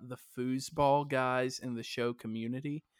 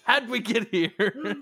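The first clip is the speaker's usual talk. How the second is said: high, loud laughing question; giggling in disbelief; 8 semitones higher, 9 dB louder than usual. Real, not acted. Laughing at the tangent.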